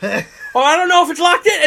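high, loud fake worry through a laugh; mock worry. Operator pranking riders, high laughing mock worry. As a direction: play up fake concern, laughing.

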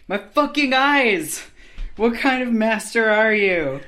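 loud, pained complaint from a blinded snake; injured basilisk yelling. Acted. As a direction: yell it at your master, hurt and outraged.

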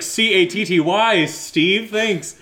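retelling the spelling bee, sarcastically thanking Steve; mock sarcastic spelling. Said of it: "So I was like".

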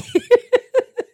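squealing laugh; a high laugh at herself; high burst of laughter. Real, not acted. Laughing at her own plea to God, high squeal.

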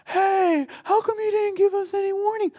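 high, loud whine; whiny complaint. Retold or imitated. People complaining they got no warning, high whine. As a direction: whine like someone caught off guard. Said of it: "people could be like".

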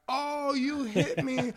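high, drawn-out mock kid whine; whiny tattling child. Parent imitating young son crying foul at his brother, mock whine. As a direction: imitate an aggrieved little boy, slow and high.

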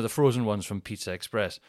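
ordinary talk, nothing marked

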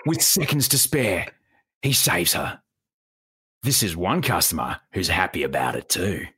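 ocker rescue voice-over, dropping low; Bondi Rescue narrator. Shown as a demonstration. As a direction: narrate a TV rescue in broad Australian, settling deep. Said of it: "ochre it up a bit".

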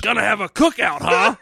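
loud mocking taunt; Freddy quip. Cohost quoting Freddy's cookout joke, loud taunt. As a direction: crow the line like a villain. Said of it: "he says something about a cookout".

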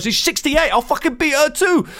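mock her chirpy promise of violence; gleeful threat. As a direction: tube woman boasting about beating a pensioner, high and gleeful. Voicing the tube woman's threat.

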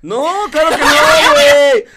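laughing loud denial; laughing shout. Real, not acted. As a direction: protests loudly through laughter. (grita riendo que no)

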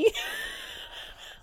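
very high wheezing laugh; uncontrollable laughter. Real, not acted. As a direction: lose it completely, squealing with laughter. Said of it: "I don't know why I'm laughing".

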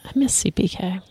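soft wistful whisper. Real, not acted. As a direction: breathe it, missing the place. Wistful aside about restaurant.

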